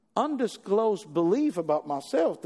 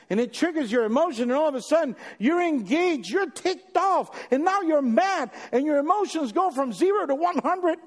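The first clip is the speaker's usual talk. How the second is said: higher and louder, climbing to a mock-angry pitch; preaching at full force; 8 semitones higher, 4 dB louder than usual. Preacher acting out an argument boiling over, rising heat. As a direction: build from calm to fired-up, like anger rising.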